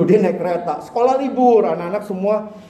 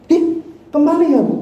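put-on childlike whine; 10 semitones higher than usual. Children answer the teacher.

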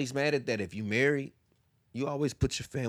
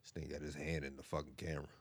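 whispering; 7 semitones lower, 13 dB quieter, faster than usual. Real, not acted. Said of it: "Why are you whispering?".

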